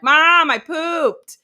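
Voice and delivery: put-on high, slow, loud toddler voice; drawn-out proud child; little kid announcing. Friend's daughter calling out to mom, toddler voice. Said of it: "their daughter is like".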